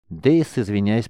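usual speaking voice

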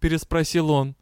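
usual speaking voice

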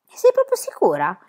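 usual speaking voice

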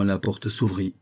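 plain reading voice

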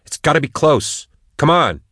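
high, urgent snap; snapped. A narrator's reading. Max urging the hunters onward, snapped. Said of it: "Max snapped".

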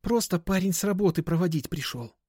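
put-on high, light girl's voice; explaining girl. A narrator's reading. Girl excuses her escort to seller, high explanation.

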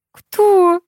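high-pitched incredulous exclamation; astonished. A narrator's reading. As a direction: ask in utter amazement. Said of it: "изумилась Аня".

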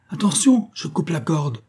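high-pitched call of warning; sudden high cry, urgent; shouted warning. A narrator's reading. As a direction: cry out a last warning before acting. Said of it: "cria monsieur Jingle".

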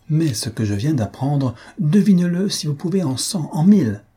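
put-on a bustling old gossip who can hardly hold her news in; voice of chatty old lady. Reader voices Mrs Jennings.

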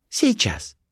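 very high short reply; Colibri's voice. A narrator's reading. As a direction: answer in a high girlish voice. Colibri puts him off.

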